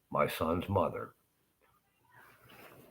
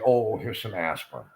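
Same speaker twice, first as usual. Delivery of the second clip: higher, louder mocking quote; mock dismissive doctor; 5 semitones higher, 6 dB louder than usual. Doctor offering aspirin to a patient in pain, mock dismissive. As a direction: imitate a doctor brushing off the patient.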